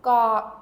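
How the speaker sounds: "Ga" said loud, stopped at the back of the tongue. Shown as a demonstration. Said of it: "I'm using the back of my tongue to stop the sound".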